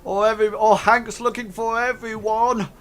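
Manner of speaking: high, loud call across the room. Acted. Another guy joking to the crowd, calling out high and loud. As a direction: heckle loudly for the whole gang. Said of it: "calls out".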